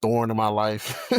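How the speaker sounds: ordinary talk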